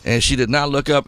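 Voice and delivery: ordinary talk, nothing marked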